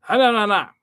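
high, loud burst of mock-Japanese syllables; mock Japanese babble. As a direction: imitate a quick Japanese line in nonsense sounds. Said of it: "o japonês, ele fala".

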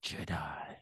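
whispered menacing villain. Retold or imitated. Quoting the trailer villain.

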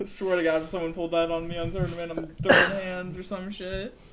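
loud, fast mock fury; hammed-up threat to fight; mock angry. Joking threat about tournament.

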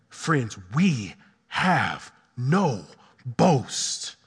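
"we have no boast" very slow and low, word by word; solemn emphasis. Real, not acted. Preacher telling friends the key line, solemnly emphatic. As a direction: let each word of "we have no boast" stand alone.

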